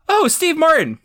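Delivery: high, loud retold exclamation; excited recognition. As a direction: imitate a thrilled celebrity sighting. Acting out her delighted recognition.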